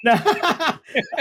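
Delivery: bursting high-pitched laugh; quick high laugh at the joke. Real, not acted.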